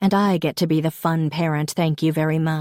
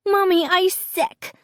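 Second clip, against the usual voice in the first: put-on very high toddler whine; little Rose. A narrator's reading. Sick daughter tells mom she is ill, toddler voice. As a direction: plead in a tiny, squeaky child's voice. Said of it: "Rose says".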